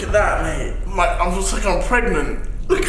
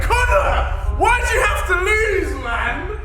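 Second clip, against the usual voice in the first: distressed wailing; 6 semitones higher than usual. Real, not acted. Challenger complains to Connor about losing the bet, distressed high wail.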